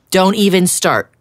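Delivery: loud grumpy snap; grousing. Acted. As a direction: cut him off crossly. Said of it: "groused Duck".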